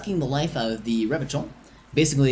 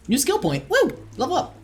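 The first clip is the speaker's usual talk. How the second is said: excited cheer; 13 semitones higher than usual. Real, not acted. Cheering a level-up in game.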